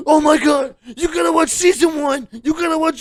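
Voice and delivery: imitating fans shouting at me to watch season one; mock gushing fan. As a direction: ham up an overexcited fan begging you to watch. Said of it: "everyone turns to me".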